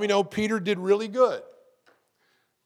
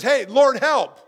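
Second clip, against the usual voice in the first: short cry for help; 6 semitones higher, 11 dB louder, slower than usual. Shown as a demonstration. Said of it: "Sometimes just".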